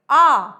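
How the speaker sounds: आ called out loudly with the mouth wide open; loud vowel demo. Shown as a demonstration. Teacher contrasting mouth opening for students, loud. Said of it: "हमने बोला ए".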